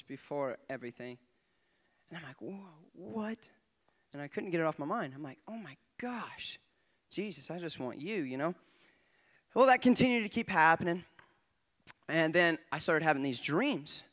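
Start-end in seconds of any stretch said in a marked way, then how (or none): none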